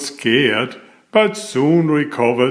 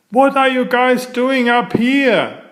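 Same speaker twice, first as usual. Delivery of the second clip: much higher squawky seagull voice; seagulls asking; 11 semitones higher than usual. Acted. Seagulls ask the flying friends, in a much higher bird voice. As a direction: ask like a curious gull. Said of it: "they asked".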